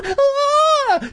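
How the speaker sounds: very high hammed-up scream; shrill scream. Boy grabbed by a hand, shrill hammed-up scream. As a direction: let out a high mock shriek. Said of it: "I let out a shrill scream".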